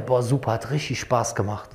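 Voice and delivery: tired but happy. Retold or imitated. Gym-goer asked about training, a touch of tired delight. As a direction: sigh it out, spent yet pleased. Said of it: "Dann sagt er".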